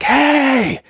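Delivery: high-pitched yell, drawn out; mock yell. Husband rehearsing his charge at the intruders, mock yell. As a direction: call out a mock attack yell. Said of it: "Yell at them".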